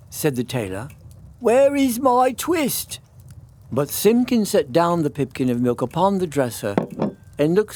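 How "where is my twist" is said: higher, slow, pleading question; anxious question. Acted. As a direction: beg the cat for an answer. Tailor questions the cat.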